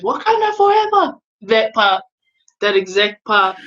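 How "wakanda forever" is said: high-pitched rallying call; mock war cry, pitched well up; acted rallying cry. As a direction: call it out like a battle salute, without full volume. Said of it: "and then he just goes".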